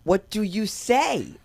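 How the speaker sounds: loud, high, drawn-out exclamation; exasperated outburst. Acted. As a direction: belt it out slowly in disbelief. Exasperated retort after offensive remark.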